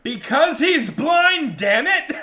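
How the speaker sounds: loud, high, drawn-out outburst; exasperated shout. Real, not acted. Game master answers player's how-does-it-work question, exasperated shout. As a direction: shout it slowly in comic exasperation.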